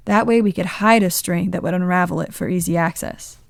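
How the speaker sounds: ordinary delivery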